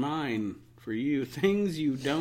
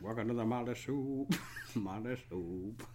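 low, slurred [name] croon; 3 semitones lower, 7 dB quieter than usual. Pretend. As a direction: mumble it like a grunge singer. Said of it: "talk like [name]".

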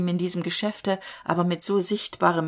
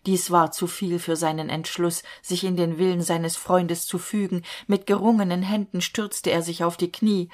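ordinary delivery

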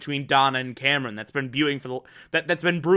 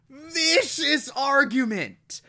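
vicious pitched way up and drawn out; stress on vicious; 11 semitones higher, slower than usual. Real, not acted. Recapping the fight for viewers, vicious hit high and slow. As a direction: lean hard on vicious, slow.

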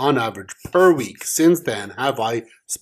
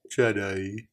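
put-on Forrest Gump accent; 3 semitones lower, 6 dB quieter than usual. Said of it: "That was good".

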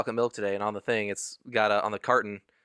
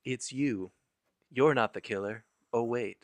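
ordinary talk

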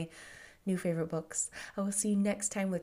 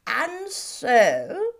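slowly; 4 semitones higher, 7 dB louder than usual. A narrator's reading. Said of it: "he said at last, slowly".

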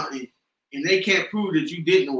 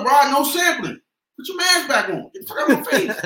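telling her off; 5 semitones higher than usual. Retold or imitated. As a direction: shut her down firmly. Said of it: "I told her".

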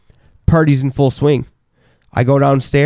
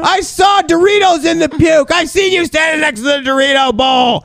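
high, hammed-up furious shouting; mad accusation; 14 semitones higher than usual. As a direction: replay a drunken freak-out, accusing at full pitch. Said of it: "I'm getting mad and I'm freaking out".

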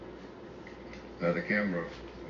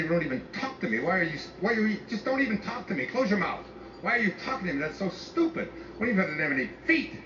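much higher, whiny put-on teen voice; mocking teenage girl; 10 semitones higher than usual. Father mimicking his grumpy morning daughter, high whiny mockery. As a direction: send up a snotty teenager who can't stand being spoken to. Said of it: "Ever heard you say".

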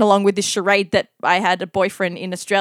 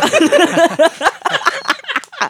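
long laugh; 7 semitones higher than usual. Real, not acted. Laughing at S1 story.

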